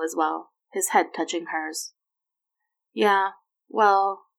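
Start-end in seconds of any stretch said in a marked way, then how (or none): 2.9-4.3 hesitant, with a gap between the words; stumbling. A narrator's reading. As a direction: fumble for an explanation, mildly awkward. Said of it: "she stumbled over any kind of explanation".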